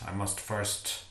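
plain reading voice

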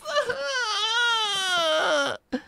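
high, crying laugh; pained laugh-yell. Real, not acted. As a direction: laugh out in disbelief. Reacting to trolling chat.

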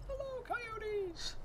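high, soft falsetto call; calling to coyotes. Pretend. Calling to coyotes in the distance, high soft falsetto. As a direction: greet them in a slow, tiny high voice.

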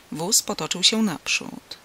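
ordinary delivery, nothing marked

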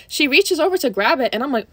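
normal talking voice

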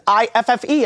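very high, mock Indonesian voice; bad Indonesian accent. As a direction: imitate an Indonesian call-center woman, high-pitched. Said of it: "forgive me for this bad Indonesian accent".

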